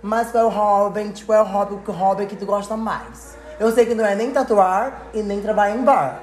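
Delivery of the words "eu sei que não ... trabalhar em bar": ordinary talk, nothing marked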